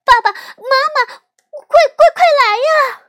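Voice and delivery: high, very loud panicked shriek; terrified scream. A narrator's reading. Terrified little sister calling her parents, screaming. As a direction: scream for help, stammering. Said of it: "她尖叫着".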